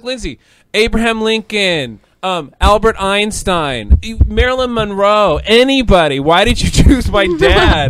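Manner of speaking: high, loud, slow listing; acted exasperation. Imitating herself confronting friend who revived dad, exasperated. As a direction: build to an incredulous protest.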